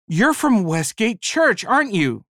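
high, slow, delighted recognition; bright and cheery. Retold or imitated. Man recognizing Liz after church, bright and cheery. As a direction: beam at her as you recognize her. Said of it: "brightly and cheerily".

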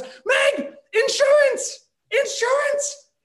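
very high, loud shout, half laughing, half crying; elated screaming. Retold or imitated. Husband tells wife they have insurance, elated high scream. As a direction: scream the good news with relief cracking through. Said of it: "I started screaming".

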